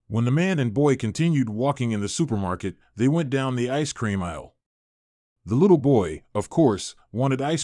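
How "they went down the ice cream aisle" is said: usual speaking voice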